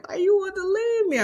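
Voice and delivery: high, loud wailing plea; crying plea. Retold or imitated. Imitating herself crying to her son: high wailing plea. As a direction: whine it like a hurt mother. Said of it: "I cried at home and I was like".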